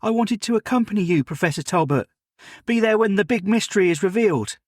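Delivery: put-on a keen boy apologising to his elder; eager servant boy Jim.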